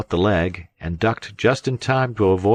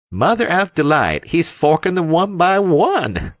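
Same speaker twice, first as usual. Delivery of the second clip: howling; 12 semitones higher, 5 dB louder than usual. A narrator's reading. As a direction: howl it out in delighted disbelief. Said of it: "howled Long Tom".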